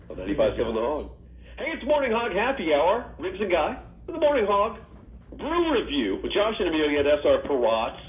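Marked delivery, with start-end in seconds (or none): 1.6-8.0 upbeat announcer read, pitched up; hyped radio host plugging the beer segment; radio promo read. Acted. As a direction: sell the show segment like a station ID.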